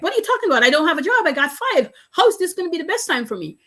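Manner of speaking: high, exasperated voice of someone laid off; mock incredulous. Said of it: "they're like".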